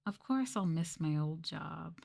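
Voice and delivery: ordinary delivery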